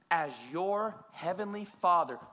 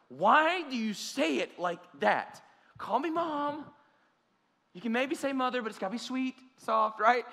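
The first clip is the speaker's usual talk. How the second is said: mom correcting him; 7 semitones higher, 4 dB louder than usual. Retold or imitated. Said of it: "And she's like".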